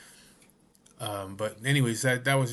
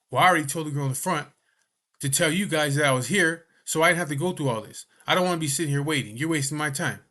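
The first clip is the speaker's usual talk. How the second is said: imitating an impatient patient, higher, louder and fast; angry complaint; 4 semitones higher, 6 dB louder than usual. A patient snapping at the nurse, mock angry and fast. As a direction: hurry them up, mock indignant.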